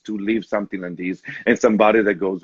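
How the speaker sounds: normal talking voice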